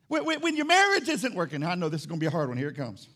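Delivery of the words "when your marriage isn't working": usual speaking voice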